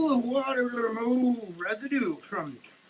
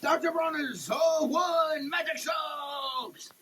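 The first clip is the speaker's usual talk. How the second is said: brand shout; 8 semitones higher than usual. Acted. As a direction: belt the brand name like a showman. Proclaiming the brand name.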